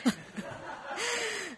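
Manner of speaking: soft high giggle; light laugh. Real, not acted. Giggling as she retells the church story. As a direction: laugh it off gently. Laughing while telling about Mary.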